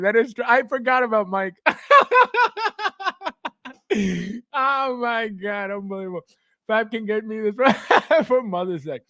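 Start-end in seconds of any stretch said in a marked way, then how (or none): 1.6-4.5 high, helpless burst of laughter; laughing. Real, not acted. Streamer remembers Mike, laughing hard. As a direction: crack up in a long high laugh. Forgot about Mike, cracks up.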